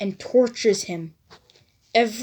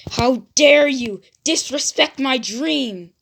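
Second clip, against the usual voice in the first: loud, higher furious demand; angry outburst; 4 semitones higher, 6 dB louder than usual. Acted. Naruto snaps at the Hokage, furious shout. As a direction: hurl it back in rage. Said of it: "Naruto gets angry".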